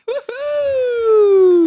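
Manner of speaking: loud high whoop; excited cheer. Real, not acted. Popping champagne to celebrate.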